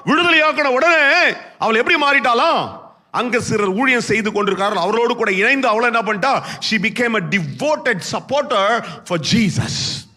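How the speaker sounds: very high, loud, driving proclamation; full-force preaching. Real, not acted. Preacher proclaiming miracles to the congregation, full-force preaching. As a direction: preach at the top of your range, loud.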